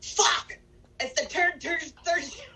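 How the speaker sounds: high-pitched mock Irish accent; Pauly's Irish accent. Co-host imagining what Pauly would say, high mock Irish accent. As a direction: exclaim it in a put-on Irish brogue. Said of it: "I was waiting for him to say".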